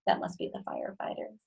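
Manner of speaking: fast, hushed whisper; whispered aside. Real, not acted. Reader telling listener a secret, whispered. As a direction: lean in and whisper it.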